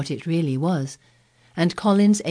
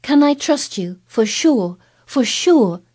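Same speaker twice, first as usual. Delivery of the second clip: higher, louder, urgent pleading; imploring; 7 semitones higher, 7 dB louder, slower than usual. A narrator's reading. Mary begging Colin for his promise, urgent and louder. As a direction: press the plea harder, begging. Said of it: "she implored".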